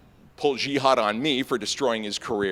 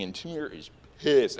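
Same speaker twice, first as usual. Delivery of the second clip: ordinary delivery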